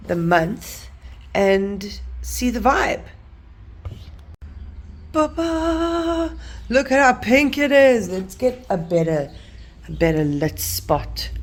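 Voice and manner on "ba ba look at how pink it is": high loud ta-da reveal; excited reveal. Real, not acted. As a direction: present it with a happy flourish. Reveals the pink spread.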